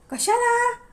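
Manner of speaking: a high, sharp, irritated why; sudden exasperated outburst; annoyed blurt. Acted. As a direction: blurt it out before you can stop. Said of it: "वैतागत Malhar पटकन बोलून गेला".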